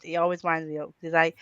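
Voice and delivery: ordinary talk, nothing marked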